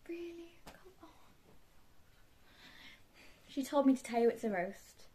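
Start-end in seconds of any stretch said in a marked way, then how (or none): 0.1-1.1 very soft, high plea; hushed protest. Acted. Villain on the phone to mum, hushed protest. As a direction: complain under your breath.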